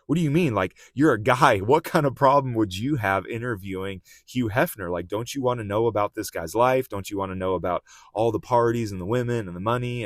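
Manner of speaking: shocked disbelief. Retold or imitated. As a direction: gasp out the questions in shocked disbelief. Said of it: "like, shocked".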